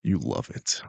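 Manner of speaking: low, lazy teasing daddy line. Pretend. Streamer finally giving chat the daddy voice, low and teasing. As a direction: drop low and purr it at them. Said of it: "the daddy voice".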